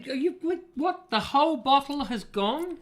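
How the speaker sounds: high, squeaky disbelief; shocked. Real, not acted. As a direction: gasp it out in disbelief. Shocked at empty bottle.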